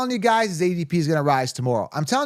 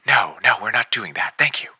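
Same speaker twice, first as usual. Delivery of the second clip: pilot voice; 6 semitones lower than usual. Acted. Still in pilot voice.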